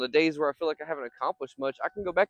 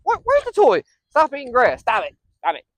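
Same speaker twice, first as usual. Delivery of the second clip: loud, higher commands; scolding the dog; 8 semitones higher, 8 dB louder than usual. Real, not acted. Owner scolding his dog for eating grass, loud and firm. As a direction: stop him firmly.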